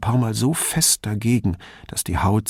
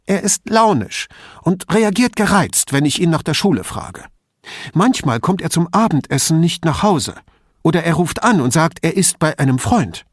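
higher-voiced anxious mother, pressing on; a worried mother confiding in the detective, higher; 8 semitones higher, 5 dB louder than usual. A narrator's reading. Mother describes son.

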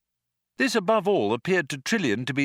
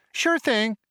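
cheery computer; 12 semitones higher, 3 dB louder than usual. A narrator's reading.

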